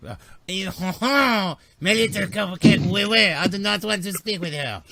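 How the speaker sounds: high, loud mock French alien accent; French Nemodian accent. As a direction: ham up a French Nemodian's refusal. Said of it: "Now they're French Nemodians".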